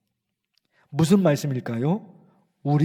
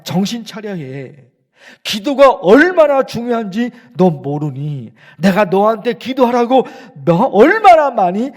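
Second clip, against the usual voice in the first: louder, higher rebuke of the disciples; scolding Jesus; 3 semitones higher, 7 dB louder than usual. Acted. Jesus scolding sleeping disciples in Gethsemane, loud rebuke. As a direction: scold them like a frustrated teacher. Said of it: "예수님이 이렇게 말씀하시는 거예요".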